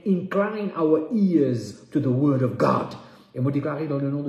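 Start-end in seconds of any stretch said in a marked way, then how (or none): 0.1-3.0 slow, emphatic preacher's cry; impassioned declaration. Real, not acted. Preacher praying for attentive ears, crying out slowly. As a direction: stretch each key word with force. Climax of prayer for hearing.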